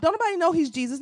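ordinary delivery

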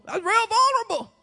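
high-pitched squeamish repeat; mock discomfort. Preacher voicing unease at asking for encouragement, high and squeamish.

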